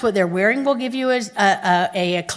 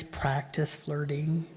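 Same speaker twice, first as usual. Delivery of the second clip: slow, soft and lower, savoring each word; savoring phrase; 6 semitones lower, 13 dB quieter, much slower than usual. Shown as a demonstration. To the class, relishing the phrase softly and slowly. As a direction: linger on it playfully. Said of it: "did you like that phrase".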